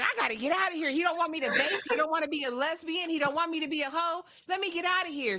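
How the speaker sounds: high-pitched agitated rant; mock flustered complaint. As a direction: imitate someone desperate to escape. Acts her flustered complaint.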